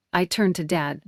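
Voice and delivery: ordinary delivery, nothing marked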